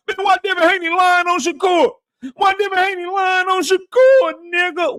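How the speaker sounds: high whiny voice mocking angry fans; mocking upset fans. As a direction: mimic crying fans melting down. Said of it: "Shakur fans crying, mad, crashing out".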